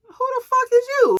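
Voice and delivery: high, loud confrontational demand; hostile challenge. Retold or imitated. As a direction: re-enact challenging a stranger, voice shooting up. Confronts unknown woman beside him.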